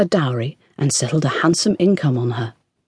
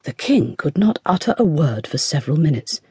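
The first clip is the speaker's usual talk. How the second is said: plain reading voice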